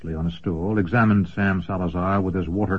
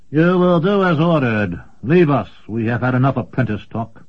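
higher, clipped commanding order; stern commander; 4 semitones higher, 4 dB louder than usual. Acted. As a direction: dismiss him with authority. Commendore dismisses apprentice sternly.

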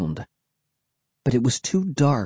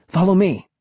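higher-pitched urging call; calling back; 7 semitones higher than usual. A narrator's reading. Will leading a friend through the swamp, calling back. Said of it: "Will called back to me".

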